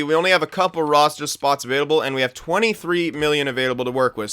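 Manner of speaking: usual speaking voice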